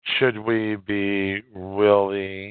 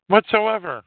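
exasperated repeat; 11 semitones higher, 4 dB louder than usual. Real, not acted. Ranting that nobody copped to anything, incredulous. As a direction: repeat the word, thrown up high in disbelief. Ranting about Hollywood silence.

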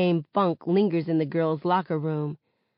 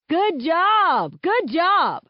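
coach cheering; 11 semitones higher, 5 dB louder, much slower than usual. A narrator's reading. Coach praising on court.

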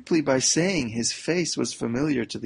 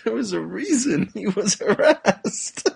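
high and louder, words cracking with laughter; laughing; 9 semitones higher, 3 dB louder than usual. Real, not acted. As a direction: squeeze it out while cracking up.

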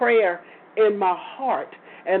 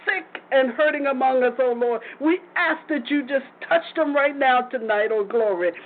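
earnest pleading prayer; 6 semitones higher than usual. Acted. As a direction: cry out to the Lord, pleading. Prayer rising to fervent pleading.

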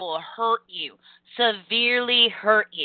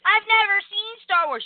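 mock clueless person; 10 semitones higher than usual. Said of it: "I happen to have this thing about".

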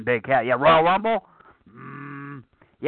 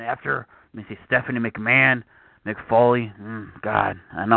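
ordinary delivery

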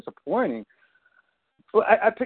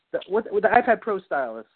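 ordinary talk

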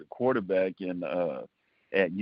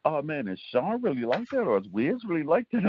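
higher-pitched retold question from friends; imitating curious friends; 7 semitones higher than usual. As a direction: lightly mimic friends asking, pitched up. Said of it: "they'd be like".

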